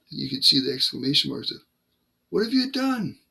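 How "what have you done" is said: alarmed Satanist. Retold or imitated. Satanist in the chat room reacting to the prayer, alarmed and high. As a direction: voice the shocked Satanist, pitched up.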